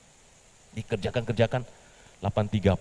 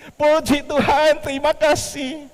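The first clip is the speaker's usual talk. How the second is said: much higher, louder, slow thanks; slow joyful praise; 9 dB louder, slower than usual. Acted. Petrus thanking God while his boss docks his pay, beaming. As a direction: answer the scolding with joy.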